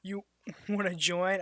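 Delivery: usual speaking voice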